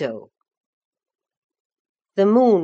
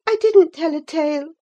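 put-on high-pitched, louder girlish denial; girl's protest; 11 semitones higher, 6 dB louder, faster than usual. A narrator's reading. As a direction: protest your innocence in a young girl's voice.

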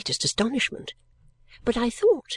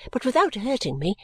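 ordinary delivery, nothing marked

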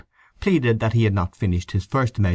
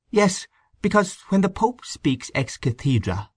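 high-pitched, earnest character voice; earnest explainer; 11 semitones higher than usual. A narrator's reading. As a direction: explain it in a raised, careful voice.

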